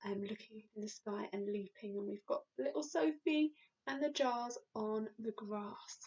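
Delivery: ordinary delivery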